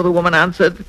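usual speaking voice

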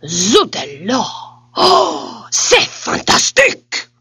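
delighted, high French exclamations; French king. Acted. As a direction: gush like a thrilled French king.

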